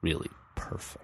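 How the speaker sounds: very soft, measured imitation, almost whispered at the end; slow and deliberate. Re-enacting the woman's weird compliment, slow and deliberate. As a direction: hush it and space out each word. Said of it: "like, very slowly and deliberately".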